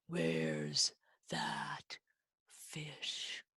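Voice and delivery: hushed, spaced-out whisper. Pretend. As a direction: whisper as if hiding from something. Hushed search for the fish.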